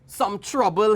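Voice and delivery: storyteller's determined monkey; monkey character. A narrator's reading. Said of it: "he said".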